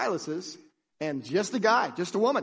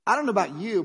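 ordinary talk, nothing marked

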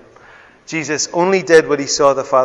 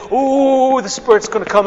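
higher, excited mock prophecy; mock hype preacher; 12 semitones higher, 7 dB louder than usual. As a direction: parody a showy preacher. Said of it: "there was no".

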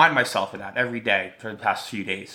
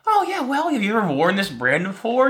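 imitating the sales lady; 9 semitones higher than usual. Sales lady voiced in story.

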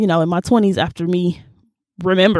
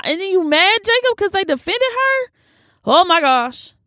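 acted shock; 11 semitones higher than usual. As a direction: play up stunned outrage in a very high voice. Acting out shocked reaction to story.

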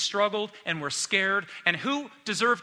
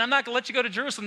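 rattled off fast and a bit higher; rushed Peter protest; 6 semitones higher, much faster than usual. Retold or imitated. Preacher quotes Peter's rushed protest, rattling it off fast. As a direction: blurt it out in a hurry.

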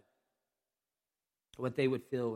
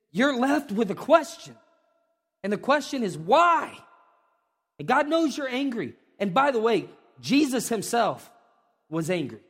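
higher, louder preaching; full-force preaching; 7 semitones higher, 7 dB louder than usual. Acted. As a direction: preach it with fire.

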